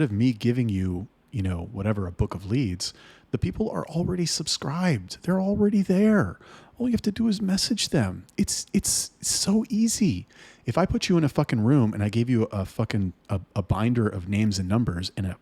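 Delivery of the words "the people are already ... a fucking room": higher, slowing down in disbelief; worked up. Real, not acted. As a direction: pitch climbing as frustration boils over. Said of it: "I get worked up about this".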